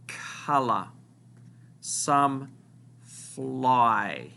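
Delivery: slow, phrase by phrase, with long pauses; slow dictation. Shown as a demonstration. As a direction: read it out slowly so the class can write. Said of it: "word that you need to write down".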